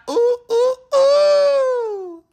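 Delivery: very high, loud hoots; excited whooping. Acted. Crowd loves the pancakes, high excited whooping. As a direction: whoop it out high, last one held long.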